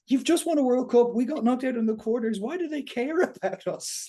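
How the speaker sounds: exasperated disbelief. Real, not acted. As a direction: rant up high, can't believe it. Exasperated rant at the Springboks.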